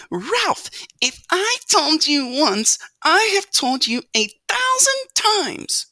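very high, loud, slow nagging; scolding mother mouse. A narrator's reading. Mother mouse scolds her son on his return, shrill scolding. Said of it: "waited to scold him".

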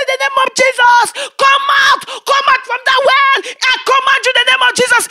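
loud, high exorcism shout; fervent retold command; commanding prayer. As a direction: drive the spirit out with every breath. Commanding the spirit to leave.

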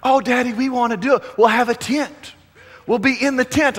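put-on high, eager childlike pleading; pleading kids. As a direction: beg Daddy like a little kid. Kids begging father to go camping.

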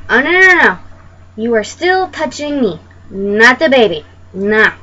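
loud, emphatic protest; indignant refusal. Acted. Pregnant woman to strangers touching her, indignant refusal. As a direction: shut them down firmly and loudly.

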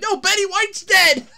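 re-enacted high, loud cry of news; retold holler. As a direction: shout the correction out to mom. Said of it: "screaming, hooting, hollering".

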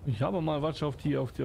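normal talking voice

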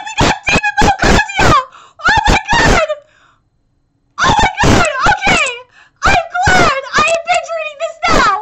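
very high, loud fan frenzy; ecstatic screaming. Real, not acted. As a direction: scream it out, losing it with excitement. Ecstatic at demon mode reveal.

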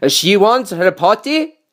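drawn-out character call; cook crying out. A narrator's reading. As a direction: pass on the cry as the cook. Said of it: "cried the cook".

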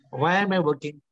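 usual speaking voice

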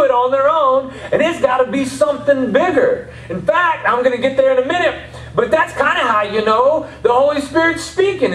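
high, sustained preaching pitch; impassioned preaching. Real, not acted. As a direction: drive the point home to the congregation, high-pitched. Preaches at full force.

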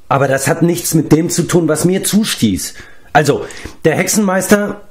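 high-pitched, peeved insistence; slightly annoyed. A narrator's reading. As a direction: brush off the interruption and resume the story. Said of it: "etwas verärgert, weil er erneut unterbrochen". (leicht verärgert)

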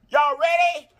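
ordinary talk

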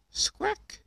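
very high piercing parrot squawk; sudden parrot screech; shrill parrot squawk. Acted. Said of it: "Polly Parrot opened her beak".

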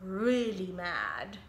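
plain reading voice

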